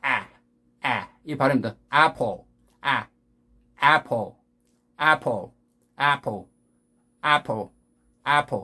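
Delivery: 애플 with wide open "a" and dark final l, "a-pol"; English pronunciation of apple. Shown as a demonstration. Teacher drilling a hard vowel, English apple. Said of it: "이거 굉장히 어려운 발음이에요".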